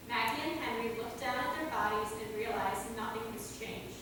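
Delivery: usual speaking voice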